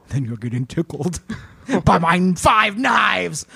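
villainous taunt building to a shout; Santa. Acted. Santa threatens victim over cookies, gleeful shouted menace. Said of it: "This is what Santa does".

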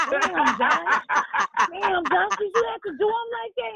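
high, laughing mock scold; a kid ribbing his brother, half laughing; teasing brother. Older brother teasing Josh about his little brother, high laughing.

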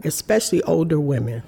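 ordinary delivery

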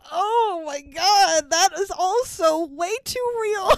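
high, drawn-out appalled reaction; stretched-out, exaggerated cringe; mock dismay. Mock-horrified reaction to the film.

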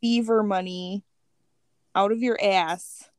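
normal talking voice

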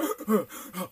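imitated out-of-breath panting; quick heavy breaths, acted out; huffing and puffing. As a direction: pant like someone who just ran home. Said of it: "he was huffing".

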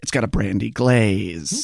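a sly confidential murmur; low aside voice. Retold or imitated. Host warns Ned about the brandy glaze in a low put-on voice.

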